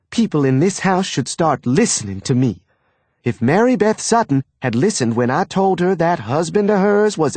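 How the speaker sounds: high, rattling Southern gossip; gossiping mother. A narrator's reading. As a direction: rattle on without stopping. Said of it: "kept talking as fast as she could".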